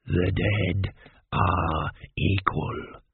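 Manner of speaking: low, very slow undead voice; ancient mummy. A narrator's reading. Ancient mummy lectures the king, low and very slow. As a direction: intone it low, word by labored word. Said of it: "said Ashk-ur-men-tep".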